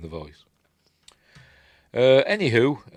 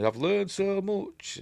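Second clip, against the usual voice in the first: high, drawn-out plea; slow, high-pitched earnest imitation; mock pleading; 11 semitones higher, much slower than usual. Luke pleading with Yoda, high mock earnestness.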